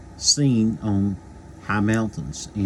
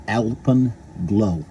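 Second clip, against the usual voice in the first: Alpenglow stretched out very slowly; slow drawn out; much slower than usual. Real, not acted. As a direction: draw out Alpenglow syllable by syllable. Drawn-out repeat of title.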